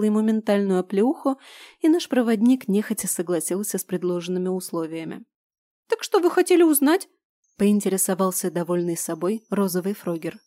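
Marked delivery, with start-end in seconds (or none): 5.9-7.1 high squeaky shouting trader; shrill little creature yelling. Acted. Blau's squeaky voice, smug.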